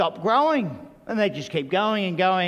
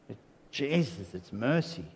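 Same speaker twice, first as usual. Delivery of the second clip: much softer and lower, tender; soft mercy; 8 semitones lower, 7 dB quieter than usual. Real, not acted. Preacher to his congregation, hushed tender contrast. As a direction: drop to a near hush on mercy.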